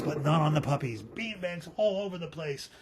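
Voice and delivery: ordinary delivery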